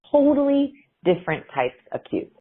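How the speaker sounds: ordinary talk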